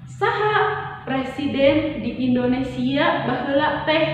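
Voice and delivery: Saha pitched high and stressed; teacher demonstrating a who question. Shown as a demonstration. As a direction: open with a bright high Saha. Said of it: "urang rubah jadi pananya jalma".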